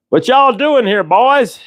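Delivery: high-pitched Southern lawman drawl; Southern cop. Acted. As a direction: call out as a mock Southern cop. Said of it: "we're in the South, and a big guy".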